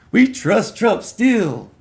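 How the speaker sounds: high, slow, dopey mock supporter voice; mock Trump supporter. Ranting vlogger mocks Trump fans in a slow, high dopey voice. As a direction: drag it out like a clueless believer.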